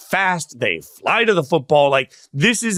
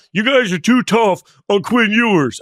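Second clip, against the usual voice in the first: a loud, raised yell of a fan's complaint; Brenneman impersonation; 5 semitones higher, 4 dB louder than usual. Retold or imitated. Impersonating Brenneman to his co-host, loud and raised. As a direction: shout it like an annoyed pundit on air. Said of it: "that's my Brenneman impersonation".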